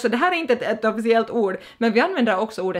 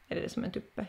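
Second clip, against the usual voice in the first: soft unvoiced whisper to herself; whispered aside; 14 dB quieter, faster than usual. Real, not acted. Vlogger whispering a puzzled joke to herself.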